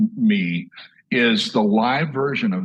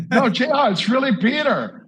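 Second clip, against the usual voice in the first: higher, louder, laughing insistence; imitating Peter insisting; 10 semitones higher, 4 dB louder than usual. Peter calls back and insists, mock pleading with a laugh. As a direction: play Peter pleading to be believed. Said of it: "All of a sudden, ring".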